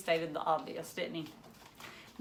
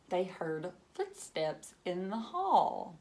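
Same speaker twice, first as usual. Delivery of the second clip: ordinary delivery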